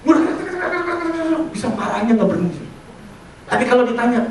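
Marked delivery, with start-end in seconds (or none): 0.1-1.5 high-pitched mock torrent of angry talk; imitated fluent rant. Imitating the brother's endless anger at papa, high rapid rant. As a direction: imitate someone ranting on without a stop. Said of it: "dia bisa lancar".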